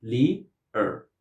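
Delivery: "Li, er" split into two beats; two syllables. Shown as a demonstration. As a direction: separate Li from er with a short gap. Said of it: "considered to be two syllables".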